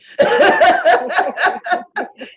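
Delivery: a big real laugh; loud laugh. Real, not acted. Host laughs at own joke.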